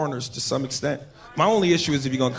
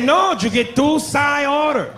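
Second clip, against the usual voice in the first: put-on slow, high Mexican accent; 9 semitones higher, much slower than usual. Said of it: "He said".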